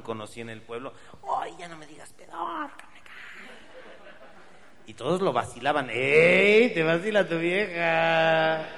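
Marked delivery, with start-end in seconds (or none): 1.4-3.1 put-on girly whine. Girlfriend's voice in joke. 5.9-8.7 loud teasing call, higher; mocking shout. Townsfolk shouting teasing at the jilted guy.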